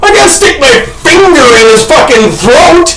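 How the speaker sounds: high, ranting shout; exasperated yelling. Retold or imitated. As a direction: yell back in helpless outrage. Acts out exasperated yelling back.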